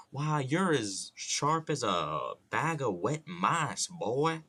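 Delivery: slow, drawn-out Southern rooster insult; mock someone in a blustering Southern drawl; stretched Southern cartoon bluster; Foghorn Leghorn. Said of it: "doing that impression".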